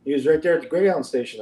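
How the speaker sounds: ordinary talk, nothing marked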